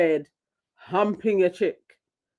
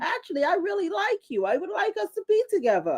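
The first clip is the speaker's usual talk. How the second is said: high mock-sweet pleading girl voice; girly voice; 12 semitones higher, 5 dB quieter than usual. Friend imitating a girl pleading with Cash, high and sweet. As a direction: coo it, much higher, like a smitten girl. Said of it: "And she was like".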